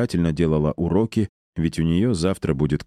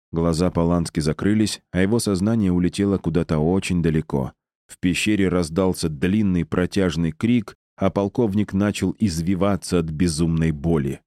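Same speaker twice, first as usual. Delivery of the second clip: ordinary delivery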